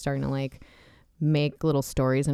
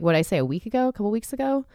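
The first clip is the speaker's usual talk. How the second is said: usual speaking voice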